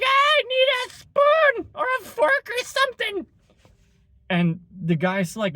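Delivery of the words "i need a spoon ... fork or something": much higher, whiny put-on Karen; mock Karen voice. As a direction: lampoon the helpless customer in a high voice. Karen asking for cutlery.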